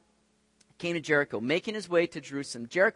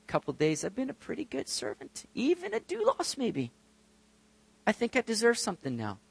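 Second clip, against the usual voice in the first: soft, high-lifted mock modesty; quiet, put-on self-congratulation; self-satisfied believer; 6 semitones higher, 6 dB quieter than usual. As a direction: coyly claim credit for good service. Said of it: "None of us come to Jesus saying".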